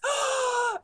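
high mock fangirl gasp; excited gasp. Imitating young fan meeting Rey, excited gasp. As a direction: imitate a kid's awestruck gasp. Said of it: "She's so excited".

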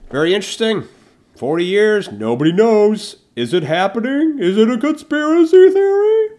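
loud, high teasing mystery build-up; rising mock-spooky announcer voice; mock dramatic hype. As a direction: sell the conspiracy like a hammed-up TV teaser. Hyping Georgia Guidestones mystery.

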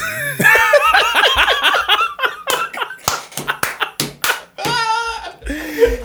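long, high-pitched belly laugh; uproarious laughter. Real, not acted. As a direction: lose it completely, laughing on and on. Cracking up at racist coworker joke.

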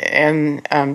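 normal talking voice

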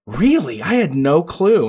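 drawn-out, swooping mock astonishment; imitated surprise. Longtime friend hearing about the weed habit, imitated surprise. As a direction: play the friend who had no idea. Quotes friends shocked by his confession.

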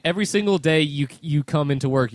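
usual speaking voice

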